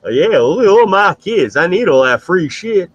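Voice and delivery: hoger, luider en traag nageaapt; mock dumb supporter. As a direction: speel hem traag en opschepperig. Mocking the supporter again.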